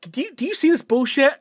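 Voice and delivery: can you believe this guy; exasperated disbelief. Retold or imitated.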